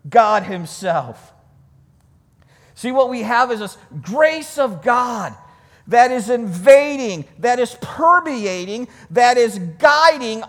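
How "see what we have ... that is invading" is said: usual speaking voice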